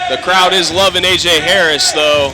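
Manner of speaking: loud play-by-play call; wrestling commentator. Acted. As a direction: hype the crowd's reaction. Live match play-by-play.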